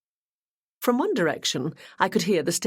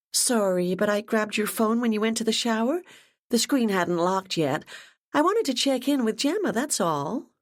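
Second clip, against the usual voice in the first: put-on higher, breezy young woman's voice; apologetic Ashley; 6 semitones higher than usual. A narrator's reading. Ashley tells Bunny about the call, higher and breezy. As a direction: brush off the phone call lightly.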